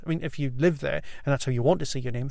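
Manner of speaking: ordinary delivery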